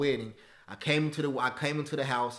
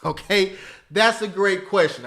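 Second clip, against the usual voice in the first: ordinary talk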